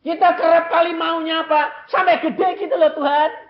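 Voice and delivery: high, loud mocking plea; fervent preaching. Preacher mimicking believers begging God, high loud preaching. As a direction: rise up pleading to God.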